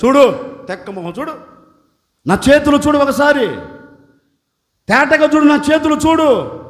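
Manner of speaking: loud, insistent command; commanding Jesus. Acted. Jesus loudly tells the woman to look at his hands. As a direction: order her to look. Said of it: "యేసు ప్రభు చెప్తున్నారు".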